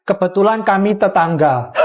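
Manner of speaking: usual speaking voice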